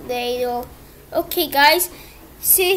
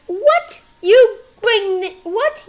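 outraged surprise; 5 semitones higher, 4 dB louder, slower than usual. Acted. ID card stolen, outraged.

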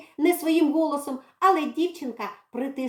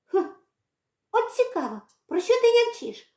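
put-on curious girl; 10 semitones higher than usual. Girl voiced.

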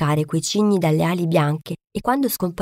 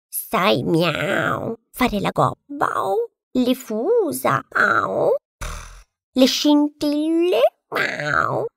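meowing cat; 5 semitones higher, much slower than usual. A narrator's reading. Cat taunts the duckling, meowing. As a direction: purr and meow through the taunt. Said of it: "ci si mise pure il gatto".

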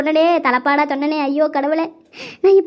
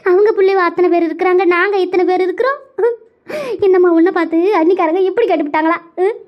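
higher, sobbing village wail; tearful lament; 3 semitones higher than usual. Acted. Daughter crying to the watching villagers over her mother, wailing. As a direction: wail each line with rising ends and sobbing breaths.

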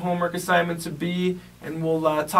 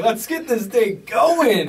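pumped up; 7 semitones higher, 4 dB louder, much slower than usual. Retold or imitated. Acted hype.

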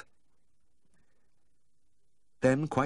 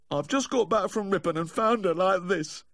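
high-pitched weepy lament; mournful drunken wail; tearful; 13 semitones higher, 3 dB louder than usual. A narrator's reading. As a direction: whimper it high, full of woe. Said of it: "he said, wiping his eyes".

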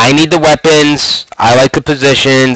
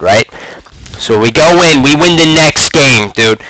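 ordinary delivery

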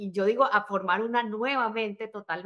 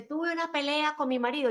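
complaining client; 6 semitones higher than usual. Retold or imitated. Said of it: "hay gente que me dice".

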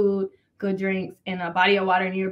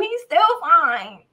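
slow, loud, higher gush; gushing shout; 7 semitones higher, 4 dB louder, much slower than usual. Real, not acted. Woman gushing over her crush, slow loud shout. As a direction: drag it out with delight.

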